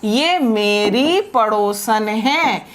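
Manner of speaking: slow, spaced-out words; teacher modelling the sentence slowly; slow model sentence. Shown as a demonstration. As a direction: leave a pause between each word. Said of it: "और फिर आप कहिए".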